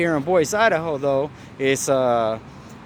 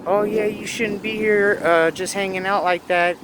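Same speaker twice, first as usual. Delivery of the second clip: scolding lady; 8 semitones higher than usual. Retold or imitated. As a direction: mimic a nagging lady, pitched up. Harassing lady, voiced.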